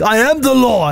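mock-grand, high declaration; hammed-up guard laying down the law; self-important security guard. Host mimics an overzealous security guard, self-important. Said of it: "he was like".

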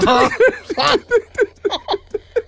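a squealing, high-pitched laugh; real shrieking laugh; high helpless laugh. Real, not acted. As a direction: laugh at your own mistake, high and breathless.

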